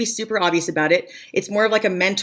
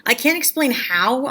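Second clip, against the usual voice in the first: ordinary talk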